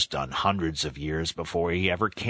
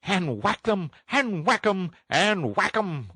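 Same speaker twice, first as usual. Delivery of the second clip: very high, gleeful toad chant; high-pitched rapture; ecstatic cry; 12 semitones higher than usual. A narrator's reading. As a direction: cry it out in rising glee, drawing out the last. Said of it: "cried the Toad in ecstasy".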